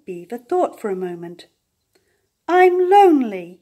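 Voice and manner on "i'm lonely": higher, louder lonely call. A narrator's reading. Little beaver calling out that he is lonely. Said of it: "he said".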